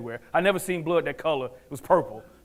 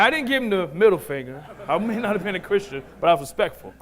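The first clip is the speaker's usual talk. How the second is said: plain reading voice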